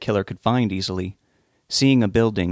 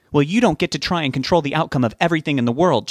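higher, exasperated retort; snapping; 6 semitones higher than usual. A narrator's reading. Betty tells off her husband, snapping. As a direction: snap back at a controlling husband. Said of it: "she snapped".

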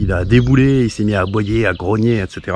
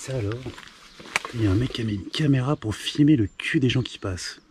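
softer, muttered disbelief under the breath; hushed astonished aside; 3 semitones lower, 10 dB quieter, faster than usual. Real, not acted. Spotting a camera filming passers-by, a hushed astonished mutter. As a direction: keep it low, like noticing something you shouldn't be overheard saying.

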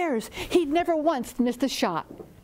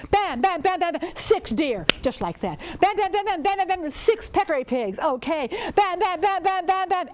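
high, loud repeated bam sounds; gunshot bams; 8 semitones higher, 4 dB louder than usual. A narrator's reading. Storyteller acting out the hunter's shooting spree, loud gunshot bams. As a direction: fire off each bam like a rifle shot.

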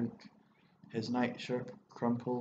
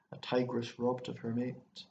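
usual speaking voice

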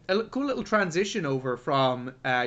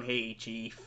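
Jack Nicholson impression; 7 semitones lower, 12 dB quieter than usual. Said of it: "Jack Nicholson sitting there going".

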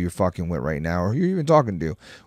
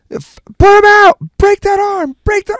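high, full-volume mock sideline yell; yelling sideline parent; 13 dB louder than usual. Imitating jiu-jitsu parents at a tournament, high-pitched yelling. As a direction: imitate a rabid parent hollering orders.

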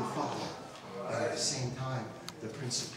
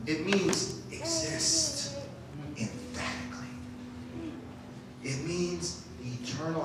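soft preaching; 10 dB quieter than usual. Acted. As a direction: hush it down, let it sink in.

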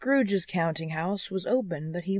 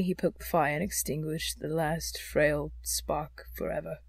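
ordinary delivery

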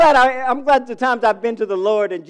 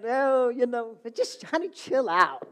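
lightly voiced wife; 6 semitones higher than usual. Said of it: "I come in".

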